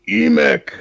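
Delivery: loud, high goblin shout; goblin yell. Acted. Cornered goblin yelling for its leader. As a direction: howl the name out desperately. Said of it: "and yells".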